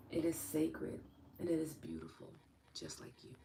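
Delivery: soft whisper closing the session; whispered blessing. Acted. Host closing the session for viewers, in a whisper. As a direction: breathe it out as a tender wish.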